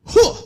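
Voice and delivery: sudden forceful "huh" burst; imitated effort grunt. Dad breaking loose from his son's hold, imitated effort grunt. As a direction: heave out one explosive breath. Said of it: "And my dad went".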